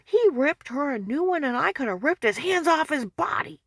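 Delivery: high, loud furious rant; retold angry yelling. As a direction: rage at what he saw. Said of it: "He said".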